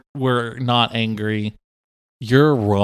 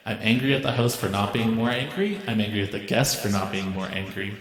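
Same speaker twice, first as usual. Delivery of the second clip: lightly voiced grumpy machine, mostly softer; angry machine letter; 6 dB quieter than usual. Podcast host reads the angry coffee grinder's letter, mild mock grumble. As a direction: read it as a sulky robot complaining. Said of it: "ask the coffee grinder for listener feedback".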